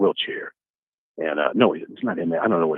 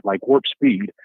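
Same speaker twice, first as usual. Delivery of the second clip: ordinary talk, nothing marked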